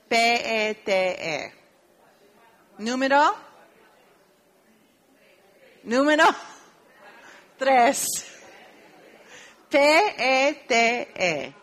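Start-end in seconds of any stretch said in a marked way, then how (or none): 0.1-1.5 Q U A L spelled out slowly, letters apart; spelling letter by letter. Shown as a demonstration. Teacher spelling the syllable to students, slow. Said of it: "spelling syllable". 9.7-11.5 T U R spelled out slowly, each letter apart and loud; spelling letter by letter. Shown as a demonstration. Teacher spelling the syllable to students, slow. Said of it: "spelling syllable".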